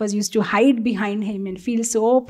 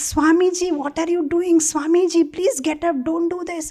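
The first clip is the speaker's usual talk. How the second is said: higher, pleading, a little panicked; flustered police officer; 6 semitones higher than usual. Retold or imitated. Shocked officer begs the bowing swami, flustered and high. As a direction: beg the swami to stand up, embarrassed. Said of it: "the police officer is like".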